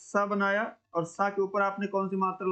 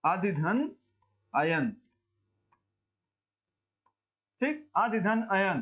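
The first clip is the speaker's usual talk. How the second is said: syllable breakdown; slower than usual. Shown as a demonstration. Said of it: "ये लिखा कैसे जाता है".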